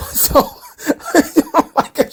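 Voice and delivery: giggling through the words; laughing. Real, not acted. Retelling a bizarre wrestling segment to viewers, laughing. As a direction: laugh while setting up the punchline.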